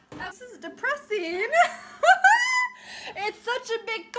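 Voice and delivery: high, laughing mock misery; laughing complaint. Real, not acted. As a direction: whine through helpless giggles. Laughing, whining over the big cup.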